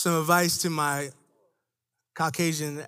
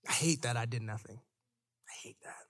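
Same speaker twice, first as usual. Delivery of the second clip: fading to a whisper; quiet shame; 9 semitones lower, 12 dB quieter than usual. Real, not acted. Preacher confessing his shame, softer to a whisper. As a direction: let it sink to a whisper. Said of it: "confessing shame".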